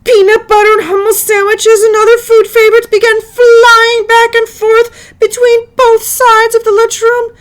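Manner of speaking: fast, loud and high, breathless; excited narration. A narrator's reading. Narrator describes the wild food fight, loud, high and fast. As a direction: race through it like chaos erupting.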